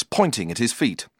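ordinary delivery

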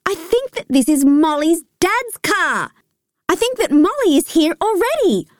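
high-pitched, mounting excitement; thrilled kid guessing who has arrived; excited. A narrator's reading. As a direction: build the excitement, pitch climbing. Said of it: "Now I am definitely excited".

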